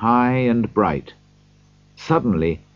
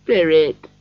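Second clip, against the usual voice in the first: high, broken call of Spirit; 8 semitones higher, 3 dB louder than usual. A narrator's reading. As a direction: grief cracking the word. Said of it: "in a broken voice".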